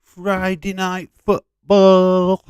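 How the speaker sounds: high, loud, drawn-out announcer call; big show intro. Acted. Host opening a football stream, big announcer shout. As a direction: belt out the title, stretching the last word.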